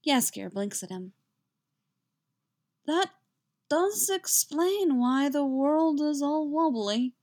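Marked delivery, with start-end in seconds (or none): 2.8-7.2 slow, high, woozy drawl; drunk-sounding. A narrator's reading. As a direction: drift through it tipsily, stretching the words. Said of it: "sounding distinctly similar to how he does when he's drunk".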